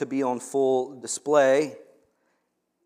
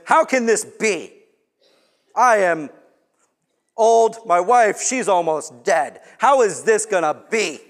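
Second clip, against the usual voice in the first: antagonistic Zechariah; 5 semitones higher, 8 dB louder than usual. Retold or imitated. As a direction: push back in disbelief, drawing it out. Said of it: "Zechariah was antagonistic in unbelief".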